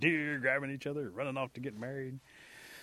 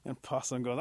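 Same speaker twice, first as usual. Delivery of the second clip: ordinary delivery, nothing marked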